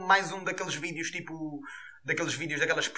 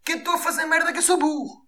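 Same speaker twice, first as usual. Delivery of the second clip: higher mock voice of a proud fool; mocking the filmer; 7 semitones higher than usual. Vlogger mocking a man filming himself, high mock voice. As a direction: play the idiot showing off his own blunder. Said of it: "naquela do".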